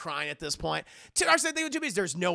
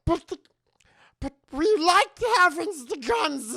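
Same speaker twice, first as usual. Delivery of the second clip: high, louder whiny creature voice; pitched-up Gollum imitation; 10 semitones higher, 6 dB louder than usual. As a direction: whine like Gollum clinging to it. Gollum Girl protests.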